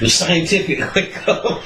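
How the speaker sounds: high, laughing through the call; laughing wake-up call. Retold or imitated. Still waking the drunk friend, laughing high. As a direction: giggle while calling him up.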